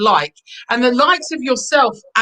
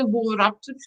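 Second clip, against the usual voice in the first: Wolverhampton accent; 5 dB quieter than usual. Shown as a demonstration. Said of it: "I have to do the accent today".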